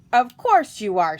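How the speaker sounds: loud, sarcastic agreement; mocking jeer with a touch of snicker; snickering fox. A narrator's reading. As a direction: scoff loudly as a sly fox. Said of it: "snickered the fox".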